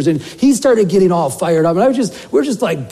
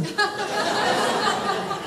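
high burst of laughter; 9 dB quieter than usual. Real, not acted. Laughs at the open door.

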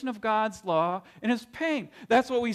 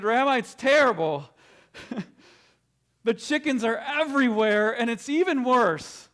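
louder, higher exasperated complaint; desperate father; 4 semitones higher, 4 dB louder than usual. Acted. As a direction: beg the rabbi for help, at wit's end.

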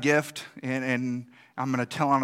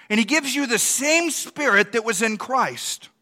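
louder, higher rousing preaching; full-force preaching; 7 semitones higher, 6 dB louder than usual. Real, not acted. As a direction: lift the room with rising fervor.

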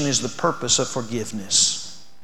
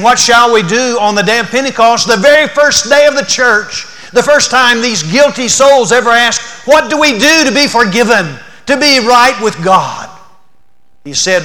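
loud, high gospel call; full-force preaching; 8 semitones higher, 12 dB louder than usual. Acted. Preacher urging the congregation to repent and be baptized, loud and fervent. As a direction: drive the call to repent home.

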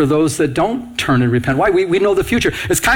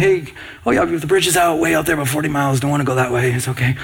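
casual offhand warning. Pretend. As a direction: toss it off like it barely matters.

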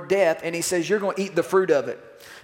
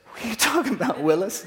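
the Lord as Gary Coleman; 3 semitones higher, faster than usual. Acted. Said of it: "and the Lord says".

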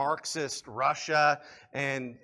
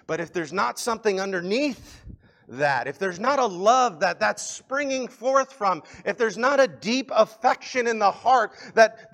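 raised pitch, forceful sermon delivery; impassioned preaching; 5 semitones higher, 8 dB louder than usual. Real, not acted. Preacher asking listeners if they love God, impassioned. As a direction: press each question home on the congregation.

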